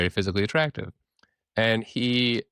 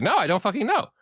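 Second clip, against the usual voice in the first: high-pitched, exasperated protest; retold defensive outburst, pitched up; mock indignant; 12 semitones higher, 4 dB louder than usual. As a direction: imitate a man insisting he's clueless. Retold man protests his ignorance.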